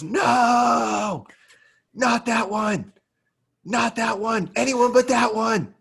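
high-pitched, strained yell of despair; retold anguished yelling. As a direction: wail it like losing the fish of the day. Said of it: "he's just yelling it".

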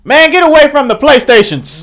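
high, loud shout to shoo someone off; shouted order. Real, not acted. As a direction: call out sharply across the room. Shooing someone away from the PlayStations.

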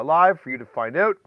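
usual speaking voice

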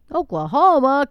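can't believe it's Oklahoma; a loud, disbelieving "Oklahoma?"; surprised. Real, not acted. Disbelief at neighbor state.

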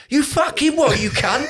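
high, barking mock outrage with a laugh; hammed-up fan snapping back; aggro football fan. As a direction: play the offended terrace bloke. Aggressive fan takes offence.

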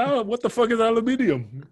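retold outburst of high-pitched confusion; mock bafflement. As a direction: act out baffled disbelief, pitch shooting up. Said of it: "I said".

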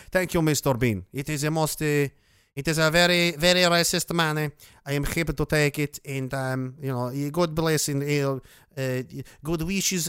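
muddled mock-Mexican accent, part insect; badly faked Mexican dude accent; weird Mexican accent. Streamer thanking a donor as a half Mexican, part insect character, weird accent. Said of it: "I don't know what that accent was".

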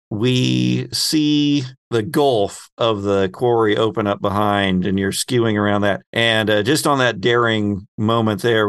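ordinary talk, nothing marked

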